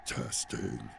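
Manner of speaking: hushed voice check; very quiet test. Shown as a demonstration. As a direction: test the character voice, barely audible. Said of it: "it's coming in very quietly".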